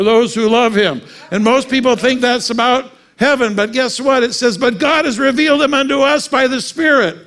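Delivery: high, loud preacher's proclamation; preaching at full force. Acted. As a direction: preach it out to the congregation. Preaching climax.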